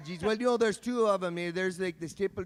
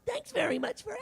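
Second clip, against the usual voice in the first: much higher silly squeak; squeaky voice; 4 dB quieter, faster than usual. Acted. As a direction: chirp it up high. Mock high reply.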